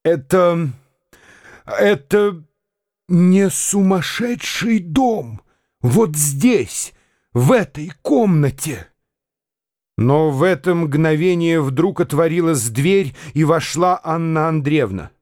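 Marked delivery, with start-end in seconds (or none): 0.0-8.9 a lightly voiced old man pleading slowly, higher; frightened old prince. A narrator's reading. Old prince clings to Arkady, frightened pleading. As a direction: tremble a little as you beg.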